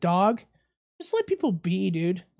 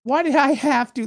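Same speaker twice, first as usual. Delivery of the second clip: higher, louder mock outburst; retold yell; 6 semitones higher, 4 dB louder than usual. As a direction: imitate someone yelling in indignation. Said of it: "People will yell at me".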